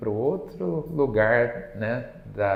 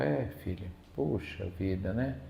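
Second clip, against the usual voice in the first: gentle consoling; 2 semitones lower, 8 dB quieter than usual. Retold or imitated. Chico consoling a sick woman.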